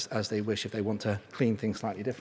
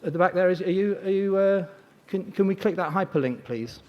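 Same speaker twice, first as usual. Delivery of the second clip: calling out; 7 semitones higher, 4 dB louder than usual. Real, not acted. Calling out to IT at back.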